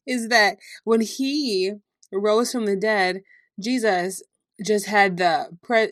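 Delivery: ordinary talk, nothing marked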